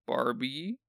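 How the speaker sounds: usual speaking voice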